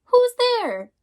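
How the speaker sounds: high, loud startled call at the door; a little mouse calling out, startled. Acted. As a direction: call out as if someone just knocked. Mouse calls out in story.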